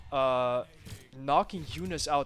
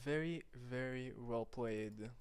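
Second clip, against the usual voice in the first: soft, slow, impressed praise; quiet awe; 2 semitones lower, 8 dB quieter, slower than usual. Real, not acted. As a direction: let it out slowly, amazed. Awed after the game ends.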